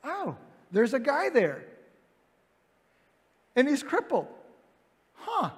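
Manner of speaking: sudden realization. Retold or imitated. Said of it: "Peter's like".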